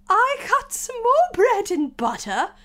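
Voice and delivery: very high, loud, slow wail; nervous Hatter. Acted. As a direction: testify in a loud, anxious squeal. Hatter continues testimony.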